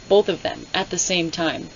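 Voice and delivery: ordinary delivery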